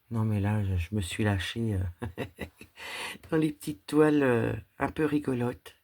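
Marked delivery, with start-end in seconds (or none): 1.9-3.2 soft breathy laugh at herself; a quiet embarrassed laugh. Real, not acted. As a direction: laugh at your own splurge. Admits she went overboard.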